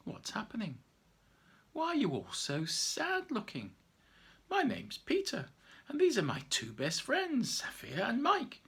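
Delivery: put-on a cheerful boy introducing friends. Peter greets the bears.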